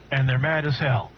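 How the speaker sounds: normal talking voice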